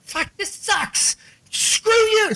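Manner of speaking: high, drawn-out comic protest; mock outrage. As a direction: whine it slowly, way up high. Acted disgust at the advice.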